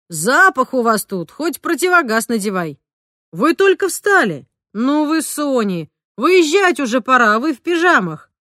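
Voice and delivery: jovial father hurrying his sleepy family; cheerful father teasing. A narrator's reading. Father teasing mother and daughter in pyjamas, hearty and slow.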